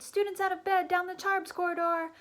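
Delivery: gleeful hollering alarm, high and loud; bellowed. A narrator's reading. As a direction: roar it to wake the castle. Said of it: "Peeves bellowed".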